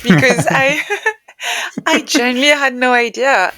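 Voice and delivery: half-laugh through the admission. Real, not acted. Author laughing while admitting she was lost.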